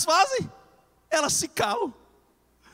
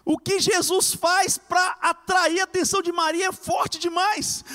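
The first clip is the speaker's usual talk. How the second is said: usual speaking voice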